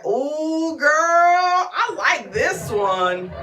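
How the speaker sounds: high, loud, drawn-out swoon; hammed-up girly squeal of approval; femininity talking. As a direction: gush slowly like a smitten girlfriend. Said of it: "the femininity was like".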